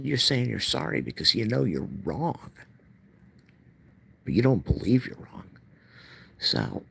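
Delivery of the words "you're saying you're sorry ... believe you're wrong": higher, insistent retelling of an argument; retold indignation. Speaker retells confronting mother, indignant. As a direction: press the accusation in a raised pitch.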